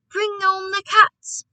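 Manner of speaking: high, slow rallying shout. A narrator's reading. Boy announcing his plan to grandmother, shouting. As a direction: call it out loud and drawn out. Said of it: "I shouted".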